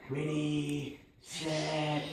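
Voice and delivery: soft ready, set; hushed countdown. Shown as a demonstration. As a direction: hold her and count down softly. Demo of holding the puppy.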